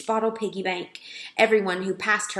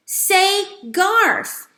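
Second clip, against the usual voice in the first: high, loud mouse cry; top of his voice; 11 semitones higher, 7 dB louder than usual. A narrator's reading. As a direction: yell it as loud as a tiny mouse can. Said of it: "at the top of his mouse voice".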